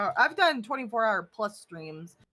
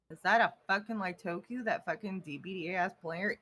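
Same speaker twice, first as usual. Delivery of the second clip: normal talking voice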